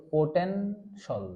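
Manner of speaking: "পোটেনশাল" slowly, ending in one syllable shol; model pronunciation. Shown as a demonstration. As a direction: slowly split it, poten... shol. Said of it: "কি হলো?".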